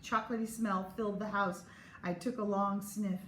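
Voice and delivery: usual speaking voice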